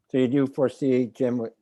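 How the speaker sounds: usual speaking voice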